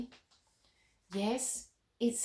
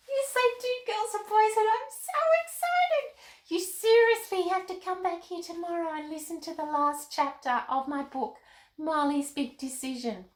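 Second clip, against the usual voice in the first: put-on much higher, bubbly young girl; little girl Molly voice; 8 semitones higher, 3 dB louder than usual. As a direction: chirp like an excited little girl puppet. Puppet Molly talks to children.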